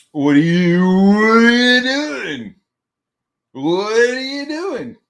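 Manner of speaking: long, high hammed-up wail; appalled parent's drawn-out howl; horrified yelling. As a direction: yell it slowly in mock horror, stretching the words. Retold shock at water in garage.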